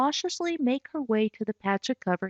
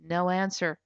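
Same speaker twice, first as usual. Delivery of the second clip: plain reading voice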